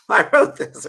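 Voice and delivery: said through a loud laugh. Real, not acted. Laughing proud admission.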